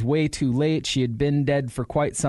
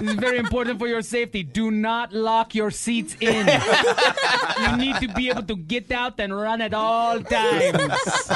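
high, mock safety announcement; hammed-up announcer, high; 7 semitones higher than usual. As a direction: play a cheery ride operator.

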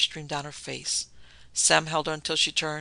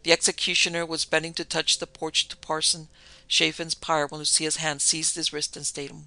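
usual speaking voice

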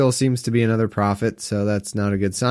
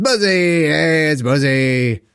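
rowdy party animal; 3 semitones higher, slower than usual. Pretend. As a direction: hype up your drinking buddy. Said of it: "frat boy's nickname".

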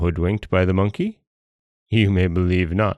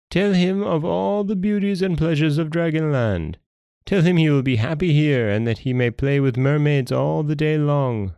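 higher, coaxing storybook king; persuasive Dragon King; 8 semitones higher than usual. Acted. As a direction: sell the pleasures as a king instructing his servant.

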